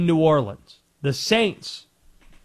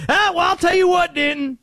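high caller voice with a put-on accent; Conspiracy Josh; 10 semitones higher than usual. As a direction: pitch it way up, in character. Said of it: "Tried to place the accent for Conspiracy John".

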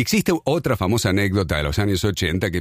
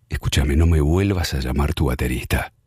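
low, firm, deliberate, lightly acted; a hint of a calm drummer putting the singer in his place; firm retort; 9 semitones lower than usual. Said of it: "le dijo firmemente".

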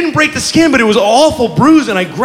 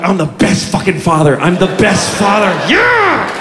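over-the-top proud dad whoop; gloating dad. Retold or imitated. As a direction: crow like the best father ever.

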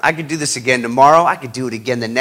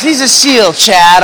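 dad pushing his point, higher and louder; insistent dad; 5 semitones higher, 6 dB louder, slower than usual. Retold or imitated. Dad brushing off his son's doubts, insistent. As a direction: push the point home like a stubborn father. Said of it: "he goes".

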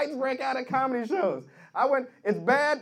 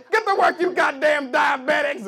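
loud, higher barked order; mock overseer; 4 semitones higher, 5 dB louder than usual. Overseer forcing diabetics to work, angry shout. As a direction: order them about harshly, hammed-up.

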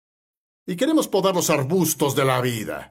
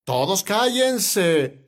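high call-out, then drawn out slowly; "listen up" shout, mildly acted; calling for silence; 5 semitones higher, much slower than usual. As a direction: hush a wailing crowd. Moses silencing the weeping people.

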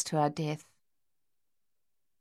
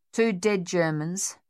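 normal talking voice

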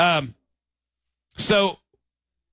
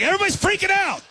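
panicked; 13 semitones higher, faster than usual. Retold or imitated. Panic at the stage.

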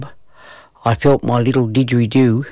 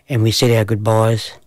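plain reading voice

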